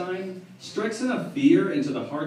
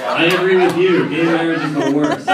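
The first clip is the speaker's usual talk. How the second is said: sarcastic; 7 dB louder than usual. Acted. Mock agreement with the crowd.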